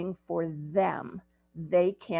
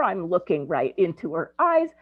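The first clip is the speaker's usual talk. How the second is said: usual speaking voice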